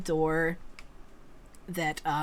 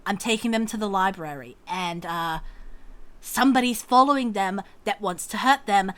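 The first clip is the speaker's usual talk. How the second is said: fast, louder huffy explanation; huffy and hurried; 4 semitones higher, 7 dB louder, faster than usual. Acted. Grian huffily tells the man they're leaving now. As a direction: rush through it impatiently. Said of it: "Grian just huffs and says".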